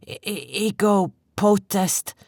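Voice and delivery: slow, high, stuttering foreign words; fearful stammer. A narrator's reading. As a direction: stammer in terror, foreign tongue. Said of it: "His voice was thick with fear".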